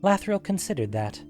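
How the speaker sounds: plain reading voice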